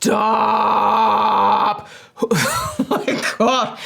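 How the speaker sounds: long-drawn exclamation breaking into breathless laughter; laughing in disbelief. Real, not acted. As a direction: stretch the word out, then gasp through laughter. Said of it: "I'm laughing because this is so absurd".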